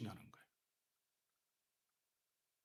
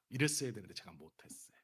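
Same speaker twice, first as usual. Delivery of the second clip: ordinary talk, nothing marked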